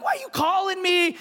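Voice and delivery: mock-heated demand; defensive. Pastor retelling confronting the caller, defensive and heated. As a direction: throw it back defensively. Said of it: "and I got heated".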